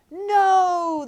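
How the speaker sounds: high, loud shout; crowd yell. A narrator's reading. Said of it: "the crowd yelled".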